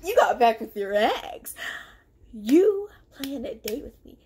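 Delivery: loud, slow accusation; accusing. Retold or imitated. Confronting Derek about getting back with his ex, loud and slow. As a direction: lay out the betrayal, loud.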